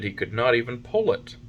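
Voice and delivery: plain reading voice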